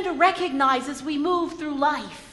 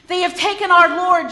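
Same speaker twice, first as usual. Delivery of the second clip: a loud, anguished cry of loss; Mary crying out to the disciples, anguished and loud; 4 semitones higher, 8 dB louder than usual. Acted. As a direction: call it out in distress over the empty tomb. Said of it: "she cried".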